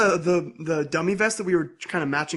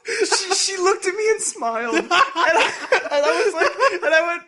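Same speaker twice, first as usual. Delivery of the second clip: higher voice breaking into laughter; laughing while telling; 11 semitones higher, 4 dB louder than usual. Real, not acted. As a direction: try to talk through real giggles. Retelling awkward moment, cracking up.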